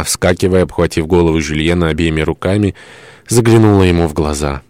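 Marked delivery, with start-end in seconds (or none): none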